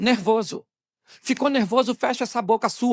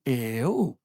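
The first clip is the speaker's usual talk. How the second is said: low, drawn-out disbelief; feigned innocence; 8 semitones lower, 4 dB quieter than usual. Acted. Husband denying to his tearful wife, feigned innocence. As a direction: play dumb on one low word. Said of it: "ele olha pra você e diz".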